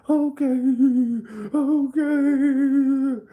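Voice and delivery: high falsetto wailing oh; mock sob. The sobbing neighbor during the hug, in a high wail. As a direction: wail the oh through fake tears.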